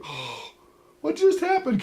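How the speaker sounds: high, baffled what-just-happened; shocked at his own order; stunned. Retold or imitated. Realizing what he ordered, high and stunned. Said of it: "I'm like".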